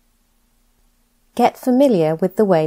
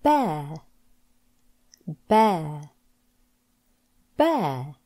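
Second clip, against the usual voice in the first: each word spoken slowly and clearly, with a falling end; a teacher's slow, clear word-by-word drill; careful pronunciation drill; 2 semitones lower, much slower than usual. Shown as a demonstration. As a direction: model every word distinctly for the learner to repeat. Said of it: "Practice these words with me to pronounce the words properly".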